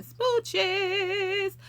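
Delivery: airy high kiss-off; smooches. Real, not acted. Signing off to viewers with a high smooch. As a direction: blow them a kiss with it.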